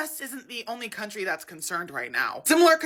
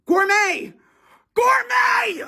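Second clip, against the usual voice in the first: much higher, loud shouted word; frustrated shout; 12 semitones higher, 6 dB louder, much slower than usual. Real, not acted. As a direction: yell the word in exasperation. Frustrated retries.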